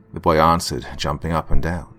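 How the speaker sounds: plain reading voice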